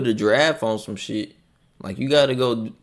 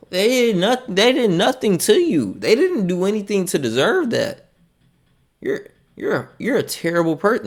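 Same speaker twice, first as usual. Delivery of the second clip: higher mock-scolding; 6 semitones higher than usual. Scolding the commenter for eating giraffe, higher mock outrage.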